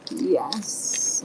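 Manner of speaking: normal talking voice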